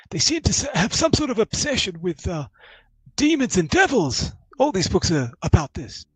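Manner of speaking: high-pitched professor voice; grumbling professor. Acted. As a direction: complain aloud to himself, pitched very high. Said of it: "you hear him mumbling".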